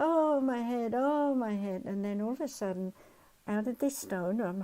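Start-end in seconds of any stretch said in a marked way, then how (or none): 0.0-1.8 louder, higher pained moaning. Retold or imitated. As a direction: groan out the headache, louder and higher. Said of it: "And she's like".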